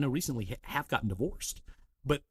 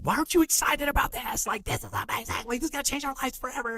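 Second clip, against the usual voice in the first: high, fast, bursting with excitement; overexcited gushing; 13 semitones higher, 4 dB louder than usual. Retold or imitated. Husband pushing his calm wife to celebrate, high excited gush. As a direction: gush about a big win to an unexcited partner. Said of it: "I'm like".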